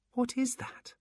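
high, wondering creature voice; curious demon voice. Acted. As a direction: ask it in wonder. Demon number one marvels.

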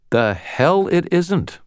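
high, slow defiant retort. A narrator's reading. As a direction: spit back the defiance slowly.

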